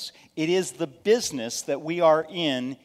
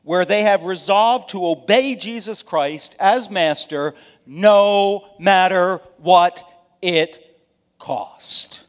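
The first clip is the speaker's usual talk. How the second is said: full-force preaching; 3 semitones higher, 9 dB louder, slower than usual. Acted. Preacher pressing the congregation toward commitment, building loud. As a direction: build louder and slower to a climax, dropping on cost.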